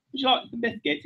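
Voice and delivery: British accent. Shown as a demonstration. Comic showing the first take, British accent. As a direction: ask politely in put-on British. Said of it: "just the British accent".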